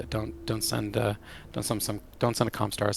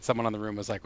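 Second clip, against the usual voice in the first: ordinary talk, nothing marked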